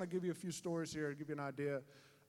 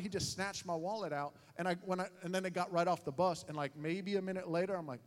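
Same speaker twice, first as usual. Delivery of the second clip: ordinary talk, nothing marked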